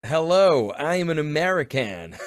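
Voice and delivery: British speaker's put-on American accent; hammed-up American accent greeting. Shown as a demonstration. Brit asked by co-host to show an American accent, hammed up. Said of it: "You do an American accent. Let's hear it".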